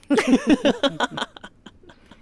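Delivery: loud high laugh, caught off guard; burst of laughter. Acted. Mother laughing at her son's joke, a loud high laugh. Mother amused by "bent Jaffa.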